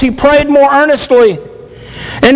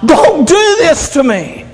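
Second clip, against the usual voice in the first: crying out; 3 semitones higher than usual. Retold or imitated. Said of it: "he's crying out to God".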